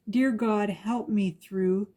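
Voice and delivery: plain reading voice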